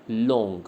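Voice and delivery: लॉन्ग drawn out as one long syllable, falling in pitch; pronunciation demo. Shown as a demonstration. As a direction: stretch the vowel in लॉन्ग. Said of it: "जब हम इसको बोलते हैं".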